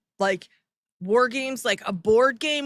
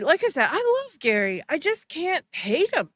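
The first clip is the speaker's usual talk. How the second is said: ordinary talk, nothing marked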